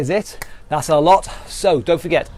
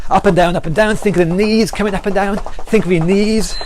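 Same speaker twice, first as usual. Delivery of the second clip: higher, pumped-up calls over the exercise; workout coaching calls; 5 semitones higher, 3 dB louder than usual. Acted. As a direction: cheer them through the round. Calling out during a workout round.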